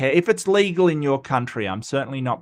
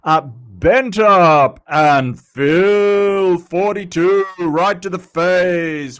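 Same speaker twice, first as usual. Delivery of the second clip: big, slow, booming announcer read; 4 semitones higher, 7 dB louder, much slower than usual. Pretend. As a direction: call the names like a ring announcer, drawn out and loud. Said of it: "get my announcer voice going".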